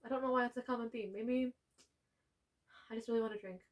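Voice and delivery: normal talking voice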